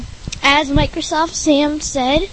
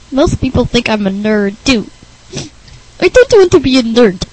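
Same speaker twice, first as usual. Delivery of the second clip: lightly whiny oddball; Rick Berner's strange voice; 7 dB louder than usual. Acted. Oddball complaining of being called a nerd, strange voice. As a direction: complain in a weird character voice. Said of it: "thinks Rick Berner talks strange".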